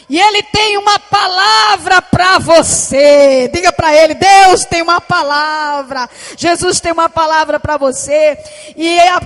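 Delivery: preaching at full force. Acted. Preacher rousing the congregation, loud proclaiming. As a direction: proclaim it to the whole church.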